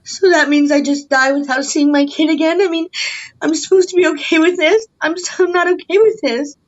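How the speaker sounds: high-pitched real indignation; distressed outrage. Real, not acted. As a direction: push the questions up in upset disbelief. Mother protests losing her child.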